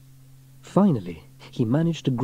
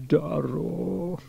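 drawn groan of dismay, pitched up; raised-pitch groaning character voice; 6 semitones higher than usual. A narrator's reading. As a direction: groan it in comic despair. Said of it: "groaned Elvis".

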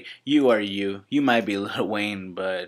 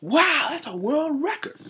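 mock amazed.

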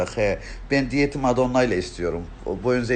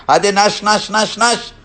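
high, loud shooing away; indignant shooing; 8 semitones higher, 6 dB louder than usual. Acted. Shooing a cheeky commenter who asked for a kiss, indignant and loud. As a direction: chase them off loudly, word after word.

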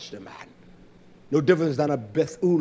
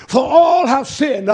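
slow shouted line; 9 semitones higher, 11 dB louder, slower than usual. Real, not acted. Repeated shout.

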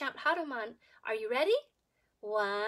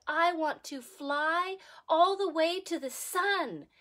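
louder, slower eager vow; eager declaration; 3 semitones higher, 5 dB louder, slower than usual. Acted. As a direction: announce the dream proudly, a bit slow. Monkey declares big wish.

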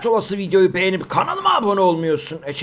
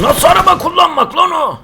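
shouting; 9 semitones higher, 8 dB louder than usual. Real, not acted. Gamer yelling at driver.

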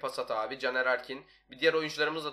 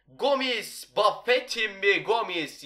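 goal call; 6 semitones higher, 5 dB louder, much slower than usual. Real, not acted.

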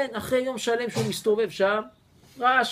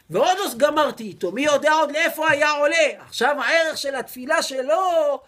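louder and higher, slowing as he marvels; rising excitement; 7 semitones higher, 8 dB louder than usual. Acted. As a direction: build up in awe at the prayer's worth. Rabbi raves about prayer's worth.

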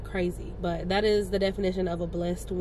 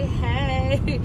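loud, higher, drawn-out "hey"; excited greeting; 5 semitones higher, 7 dB louder than usual. Real, not acted. Vlogger popping in to greet viewers, excited drawn-out hey. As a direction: sing out a big hello. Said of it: "come in and say".

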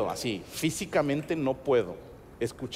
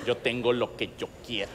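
put-on lower, softer pouty girl voice; imitating Adriana; 5 semitones lower, 7 dB quieter than usual. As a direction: whine it like a spoiled child. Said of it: "imitar las voces de Alfredo y Adriana". (voz caprichosa de niña, baja)

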